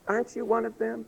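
put-on high, soft little-girl voice. Little girl questioning Peter, high and small. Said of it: "And this little girl, she goes".

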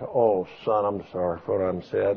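low, soft apology from a mother-in-law; retold apology. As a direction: imitate her quietly making up. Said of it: "Everybody was sorry".

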